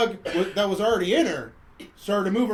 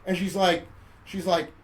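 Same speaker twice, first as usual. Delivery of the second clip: ordinary talk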